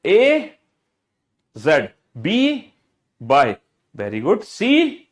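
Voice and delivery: letter held very long, inviting the answer; prompting the class. Shown as a demonstration. Teacher says one part, pupils the other, drawn-out prompting. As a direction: draw the letter out and wait for pupils. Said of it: "मैं बोलूंगा एक part, दूसरा part आप बोलोगे".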